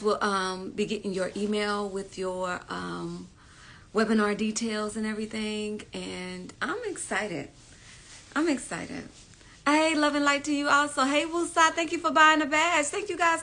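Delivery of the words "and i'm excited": drawn out very slow and softer; slow, hushed. Acted. Telling viewers about the webinar, slow and hushed. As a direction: savour every word softly. Repeating her excitement.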